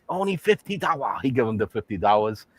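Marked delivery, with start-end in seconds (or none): 0.1-1.2 put-on raised-pitch caricatured Chinese accent; mock Chinese accent. Shopkeeper greets a customer, mock Chinese accent. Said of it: "The Chinese man".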